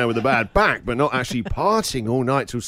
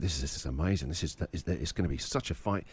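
much lower and softer, almost to himself; hushed awe; 10 semitones lower, 12 dB quieter, faster than usual. Retold or imitated. Dad thinking as the fight begins, hushed and much softer. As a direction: breathe it in awe as the fight starts. Dad's hushed thrilled inner thought.

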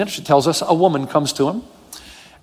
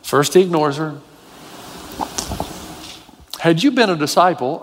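ordinary talk

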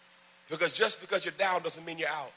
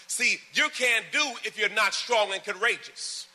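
high, loud, slow emphasis; preaching, slowed down; 6 semitones higher, 5 dB louder, slower than usual. Acted. Pastor pressing the verse on the church, slow and strong. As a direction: spell it out slowly with force.

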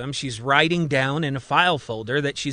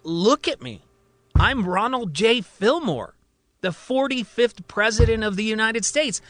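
higher, proud self-proclaimed president; insistent patient; 7 semitones higher than usual. A narrator's reading. Patient insists to doctor he is president, higher pitched. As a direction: puff up and insist on who you are.

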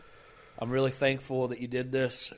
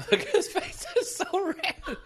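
high squeaky laughing words. Real, not acted. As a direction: laugh while you say it. Laughing at his red face.

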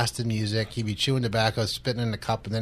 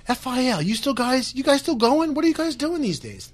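much higher, incredulous stranger's voice; imitated surprised passer-by; 11 semitones higher than usual. Stranger spotting the station shirt at Wawa, surprised. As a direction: mimic someone amazed they still exist.

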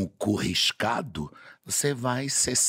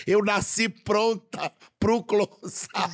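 diva boast; 8 semitones higher, slower than usual. Pretend. Diva boast after film quote.

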